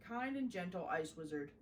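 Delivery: plain reading voice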